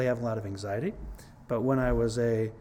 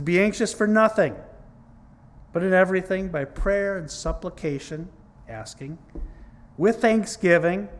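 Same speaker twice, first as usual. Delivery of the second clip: louder, higher proclaiming read; scripture reading; 5 semitones higher, 4 dB louder than usual. Acted. Preacher reads Philippians to the church, fervent proclaiming.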